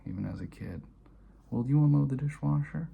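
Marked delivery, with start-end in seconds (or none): 1.5-2.9 loud, high, sharp demand; father's loud demand. Retold or imitated. Father ordering his kid to unload the dishwasher, loud and high. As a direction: bark it out like an impatient father.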